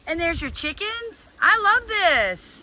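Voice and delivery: high, loud and drawn out; thrilled. Real, not acted. Visitor sees the slop and chickens, thrilled. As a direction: gush it slowly in sheer delight. Delighted by the farm.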